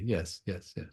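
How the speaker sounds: normal talking voice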